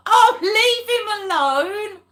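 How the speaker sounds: high, loud, drawn-out mock plea; exaggerated "poor thing" protest; mock protective plea. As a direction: wail it in playful sympathy. Playful protest defending teased Phil.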